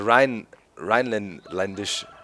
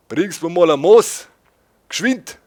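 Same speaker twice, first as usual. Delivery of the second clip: loud, much higher Bavarian beer order; Bavarian dialect; 9 semitones higher, 9 dB louder than usual. Shown as a demonstration. Ordering beer in Bavaria, loud Bavarian voice. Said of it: "you would go".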